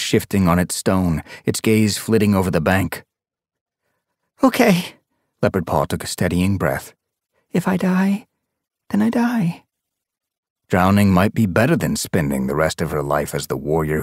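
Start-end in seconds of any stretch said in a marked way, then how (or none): 4.4-9.6 put-on high young cat voice; resigned resolve. A narrator's reading. Leopard Paw braces herself, high and resigned. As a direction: steel yourself and commit.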